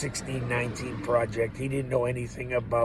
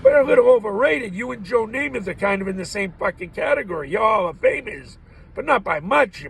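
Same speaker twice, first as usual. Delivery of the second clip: mock rant; 9 semitones higher, 4 dB louder than usual. Real, not acted. Ranting at Brett Favre.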